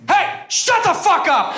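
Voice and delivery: high, slow, drawn-out tough-guy shout; hammed-up slow yell of a passenger; New Yorker yell. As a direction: bellow it like a fed-up New Yorker. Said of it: "who just goes".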